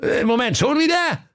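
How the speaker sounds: sehr hoch, verdutzt; ungläubig hoch nachfragen; erstaunter Diener. Acted. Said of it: "sagte der Hofmarschall Hering". (verdutzter Diener)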